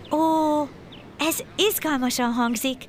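high excited chick asking along; eager request. A narrator's reading. Little sparrow asks the stork to take it along, high eager request. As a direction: burst out high, then ask keenly. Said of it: "kérte újdonsült barátját".

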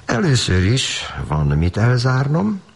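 playful, knowing reply; teasing wink. Acted. Brother teasing his little sister with a knowing wink. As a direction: tease her with a wink. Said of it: "a kishúga felé kacsintott".

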